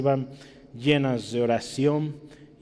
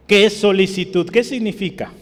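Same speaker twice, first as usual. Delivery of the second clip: higher, much louder proclaiming; preaching emphasis; 6 semitones higher, 8 dB louder than usual. Acted. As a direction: hammer the question home. Retoma el versículo con fuerza. (predicación enfática, más fuerte)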